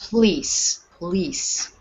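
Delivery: "police" squeezed to sound like "pleece"; reduced first syllable. Shown as a demonstration. Teacher showing that the O is unstressed, "police" said almost as "pleece". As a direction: swallow the O in "police", all weight on the end. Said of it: "The P O is almost like P".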